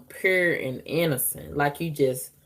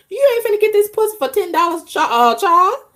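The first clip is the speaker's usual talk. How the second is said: high, fast, louder telling-off; sassy call-out; 4 dB louder, faster than usual. Acted. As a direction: snap it right at Charles. Telling Charles off directly.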